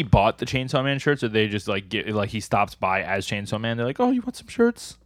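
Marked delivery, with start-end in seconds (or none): 4.0-5.0 very high, put-on offering voice; imagined shirt giver. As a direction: pipe up with a mock-eager offer.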